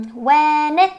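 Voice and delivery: loud "when it", n carried into it; linked words. Shown as a demonstration. Teacher showing linking in "when it", emphatic demonstration. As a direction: join "when it" as one word, emphatically. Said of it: "nối này".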